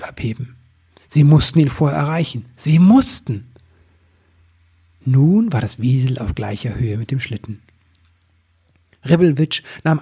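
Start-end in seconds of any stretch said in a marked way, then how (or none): none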